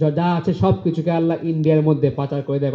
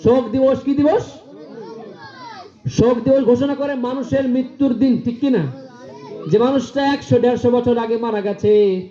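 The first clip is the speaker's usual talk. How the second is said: high, ringing preacher's cry; fiery preaching; 8 semitones higher than usual. Acted. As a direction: preach it out to the crowd. Preaching at full force.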